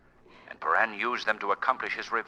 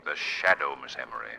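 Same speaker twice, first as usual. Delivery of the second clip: mysterious unseen hero; 10 semitones lower than usual. Acted. Unseen hero announcing himself in the dark, low ominous voice. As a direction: speak from the darkness.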